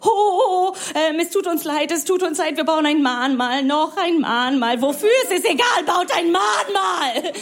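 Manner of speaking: high, hammed-up mock crying apology; sobbing guilty Germany. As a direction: wail it out like overwrought remorse, building to a shout. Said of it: "Deutschland ist so".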